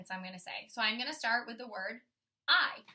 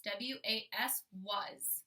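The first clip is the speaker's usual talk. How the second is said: spelled slowly then the word, softer; slow, softer spelling; 5 dB quieter, slower than usual. Shown as a demonstration. Teacher repeating the spelling for kids, slow and soft. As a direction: spell it again slowly and say was softly. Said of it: "my sight word was".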